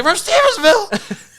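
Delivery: high, loud, slow incredulous cry; can't believe what he's hearing; appalled disbelief. Retold or imitated. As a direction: ranger shouting in disbelief across the river. Appalled ranger questioning.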